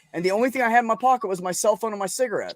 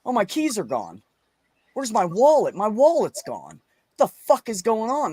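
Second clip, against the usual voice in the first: retold panic; 5 semitones higher, 4 dB louder than usual. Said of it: "So I go".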